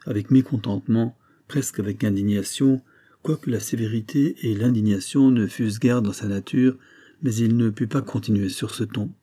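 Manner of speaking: plain reading voice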